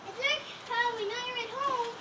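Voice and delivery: high-pitched call through a door. Acted. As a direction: call up to someone shut indoors. Kids calling at neighbor's door.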